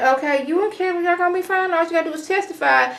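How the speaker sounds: high, chirpy mock-cheerful Annalise; Annalise reassuring. Annalise brushing off Wes's mom, high mock cheer. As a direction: pitch it up, breezy and dismissive.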